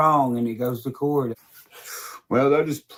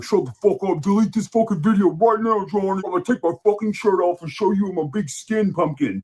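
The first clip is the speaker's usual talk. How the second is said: mocking Bobby; 8 semitones higher than usual. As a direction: bluster it in a silly high voice. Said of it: "being like".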